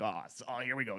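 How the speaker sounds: weary groan. Retold or imitated. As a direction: sigh out, fed up. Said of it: "'cause they're like".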